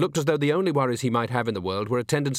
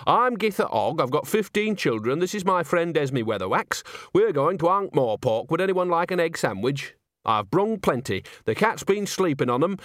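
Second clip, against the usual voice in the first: higher, chatty, bustling granny voice; cheery old countrywoman; 5 semitones higher than usual. Acted. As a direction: natter away merrily to strangers. Nanny Ogg chatting on coach.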